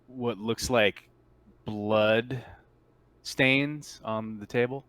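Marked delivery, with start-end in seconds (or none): none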